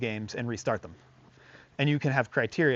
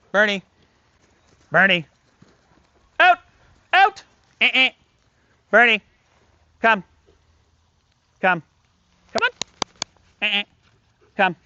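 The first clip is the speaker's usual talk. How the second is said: loud, higher, firm calls; firm commands; 9 semitones higher, 10 dB louder than usual. Shown as a demonstration. Trainer ordering the dog to drop the toy, loud and firm. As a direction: call him off sharply to end the game. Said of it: "And make him drop it".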